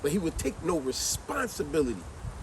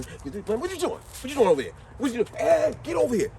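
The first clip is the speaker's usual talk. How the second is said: suspicious parent; 7 semitones higher than usual. Retold or imitated. Parent scolding suspicious kid.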